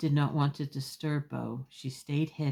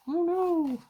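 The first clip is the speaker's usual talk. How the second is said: dismayed oh no. Acted. Octopus dismayed in story.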